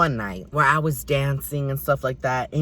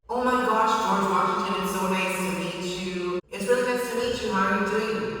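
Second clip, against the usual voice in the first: lightly acted eager politeness; gushing greeting; 3 semitones higher, 4 dB quieter than usual. Meeting George Washington for the first time, gushing.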